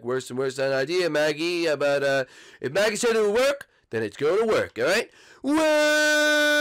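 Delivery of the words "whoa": falling yell. Acted. Bart falls off building.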